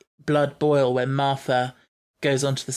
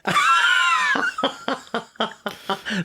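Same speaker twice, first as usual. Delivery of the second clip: high, helpless burst of laughing. Real, not acted. Co-host cracks up at cha-cha-cha.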